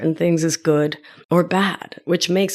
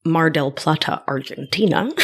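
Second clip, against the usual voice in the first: American accent; 4 semitones lower than usual. Shown as a demonstration. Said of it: "it's really cracked me up with that accent".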